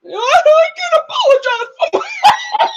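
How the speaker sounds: high, slow mock sob broken by laughter; laughing while faking a wail; imitating crying. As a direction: ham up her tearful apology. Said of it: "So Jenny starts crying".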